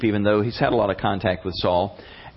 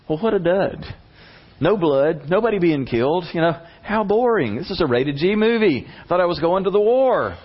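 higher, sarcastic disappointed whine; mock boredom; 6 semitones higher than usual. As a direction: sigh it out like a let-down kid. David bored at the battle lines.

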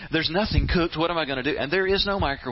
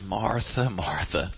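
low, soothing address; gentle calming; 6 semitones lower, slower than usual. Acted. Jesus answering overwrought Martha, gently calming. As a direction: say the name low, easing off on the repeat.

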